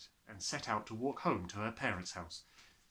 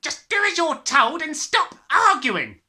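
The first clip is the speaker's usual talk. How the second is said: loud, much higher, slow angry father; snapped; 16 dB louder than usual. Acted. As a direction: snap at the child impatiently. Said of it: "the father snapped".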